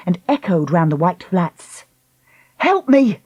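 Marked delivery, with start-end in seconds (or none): 2.6-3.2 raised, urgent call; crying out. Acted. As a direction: call for help. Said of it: "cried Lucy".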